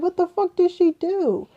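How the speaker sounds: high, incredulous cry of disbelief; retold high-pitched shocked outrage; shocked outburst. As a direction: blurt it out in total shock.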